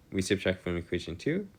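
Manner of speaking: usual speaking voice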